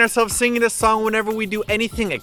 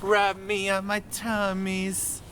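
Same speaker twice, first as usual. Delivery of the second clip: slow breathy; 8 dB quieter, slower than usual. Retold or imitated. Quoting song slowly.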